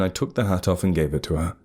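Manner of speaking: ordinary delivery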